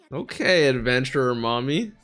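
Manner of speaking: ordinary talk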